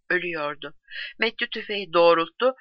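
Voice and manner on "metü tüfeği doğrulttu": ordinary delivery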